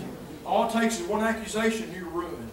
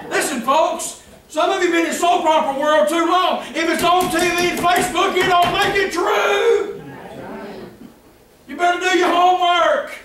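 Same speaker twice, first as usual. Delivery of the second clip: higher, louder scolding sermon; preacher scolding his flock; fiery preaching; 8 semitones higher, 6 dB louder than usual. Acted. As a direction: drive the warning home from the pulpit. Pastor rebukes the congregation.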